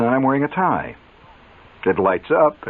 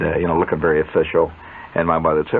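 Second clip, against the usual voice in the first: normal talking voice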